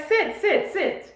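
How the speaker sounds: high, quick-fire imitation of the teller; imitated urging. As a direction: mimic her insisting in a high voice. Retold teller ordering them to sit.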